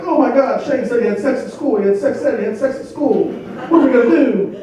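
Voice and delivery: high-pitched panicked wife impression; frantic, repeating herself in a mock panic; freaking out. Wife phoning husband about son's trouble at school, freaking out. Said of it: "My wife's freaking the fuck out".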